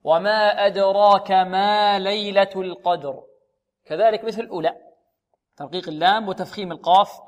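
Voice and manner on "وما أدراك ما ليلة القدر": slow, measured recitation; Quran recitation. Shown as a demonstration. Teacher recites the verse slowly for the students. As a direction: chant the verse slowly, as in tajweed.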